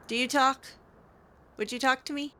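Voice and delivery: ordinary talk, nothing marked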